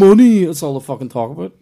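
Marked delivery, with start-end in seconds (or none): 0.0-0.4 high, loud mock Dubliner; mock Dublin accent. Sending up money-obsessed Dubliners, loud Dublin accent. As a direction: call it out in fake Dublin. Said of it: "Dublin's always like that".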